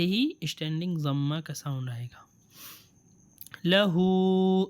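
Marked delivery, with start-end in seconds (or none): 3.6-4.6 لہو drawn out very slowly, louder; slow reading demo. Shown as a demonstration. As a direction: stretch لہو with a long oo. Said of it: "یہ بھی same".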